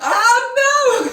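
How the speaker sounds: high, drawn-out cry of dismay; playful dismay. Real, not acted. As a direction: wail it, half amused. Dismayed reaction to second gun.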